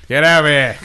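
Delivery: loud, laughing mock Australian accent; mock Australian press-gang officer, laughing. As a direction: yell it in a hammed-up Australian voice. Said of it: "I went a little Australian with that".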